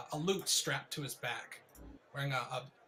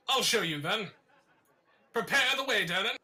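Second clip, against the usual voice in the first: put-on higher, louder cocky young man; boastful young man; 6 semitones higher, 6 dB louder than usual. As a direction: swagger through the boast.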